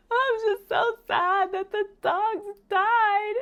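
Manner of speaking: very high, drawn-out mock wail; imitated crying child. Daughter explains her tears to mom, hammed-up crying. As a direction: wail it slowly like a tearful kid. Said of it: "in her closet crying hysterically".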